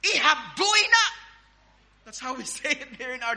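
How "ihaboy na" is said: loud, high-pitched shout; forceful dialect command. Acted. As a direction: yell it out with real relish. Repeats dialect command with feeling.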